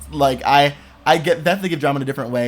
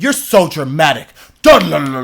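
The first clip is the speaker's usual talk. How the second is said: mocking older brother; 8 dB louder than usual.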